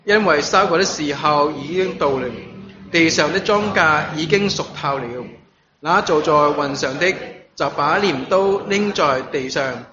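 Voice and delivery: solemn recitation in even phrases; scripture reading. A narrator's reading. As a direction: read the verses out clearly to a congregation. Reads the scripture passage aloud.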